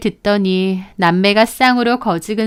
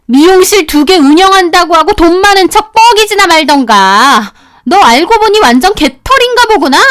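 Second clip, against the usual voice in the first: angry tirade; 6 semitones higher, 5 dB louder than usual. Acted. Sister-in-law scolding her brother's wife, furious yelling. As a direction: berate her like a furious elder.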